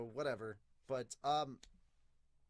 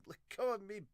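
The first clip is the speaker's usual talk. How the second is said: put-on higher, stammering anime girl; flustered princess; 10 semitones higher, 4 dB louder than usual. Princess reacting to Yami holding her, flustered high stammer. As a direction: protest in a raised voice, stumbling on the insult. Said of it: "She reacts by saying".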